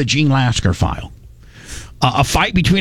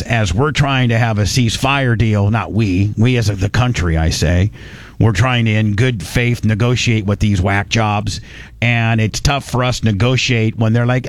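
ordinary talk

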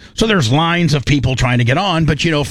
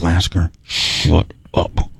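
much lower and very slow, dragged out; mock deep shut-up; 10 semitones lower, much slower than usual. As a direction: drag it down low and slow, like a grumpy put-on voice. Telling Lasker off in story.

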